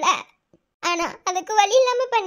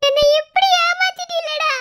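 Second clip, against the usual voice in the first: much higher, louder wail; wailing accusation; 7 semitones higher, 4 dB louder than usual. Acted. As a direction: cry out that he betrayed her. Accusing lover in anguish. (உயர்ந்த குரலில் அழுகை குற்றச்சாட்டு)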